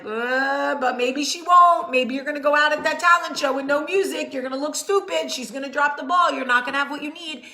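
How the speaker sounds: mock panicky voice of anxiety nagging the child; anxious inner voice. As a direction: voice the panicky doubt in her head. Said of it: "that one percent of your brain that's like".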